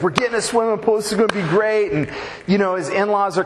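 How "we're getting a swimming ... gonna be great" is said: excited Clark. Retold or imitated. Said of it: "He's like".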